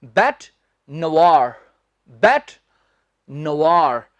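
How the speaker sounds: बैट नवार said "bet nuh-vaar", slow; correct pronunciation. Shown as a demonstration. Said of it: "आसान भाषा में बता रहा हूं".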